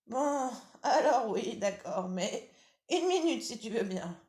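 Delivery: high, loud granny voice with laughter; old grandmother chuckling. Acted.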